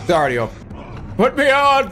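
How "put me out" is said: frantic shout. Real, not acted. Frantic gaming outburst.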